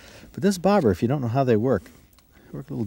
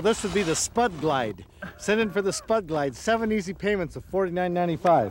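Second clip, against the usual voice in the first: high, hyped TV pitchman; infomercial announcer; 9 semitones higher than usual. Pretend. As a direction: sell it like a late-night ad. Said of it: "if we're doing an infomercial".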